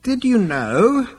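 slow, very high interruption; ghostly old ancestor. A narrator's reading. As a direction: blithely cut in as an old man. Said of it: "interrupted the ghostly figure".

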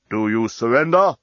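understated loudspeaker demand; booming. A narrator's reading. Enemy fleet demanding surrender after firing, booming. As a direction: put the formal question with measured authority. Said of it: "The voice boomed over the loudspeaker".

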